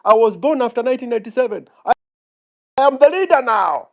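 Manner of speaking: high, cocky parody of a know-it-all; mock the entitled young upstart; mocking Gen Z voice.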